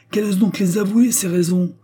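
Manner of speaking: high, loud, defiant challenge; challenging riposte. A narrator's reading. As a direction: dare the other person angrily. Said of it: "Riposta madame Clotilde, en défiant du regard sa fille".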